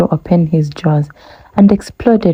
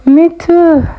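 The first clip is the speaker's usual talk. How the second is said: much higher, louder laughing reply; 12 semitones higher, 4 dB louder than usual. Acted. Hyena agreeing with the giraffe, high and laughing. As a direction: chirp it out mid-laugh. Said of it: "the hyena put in with a laugh".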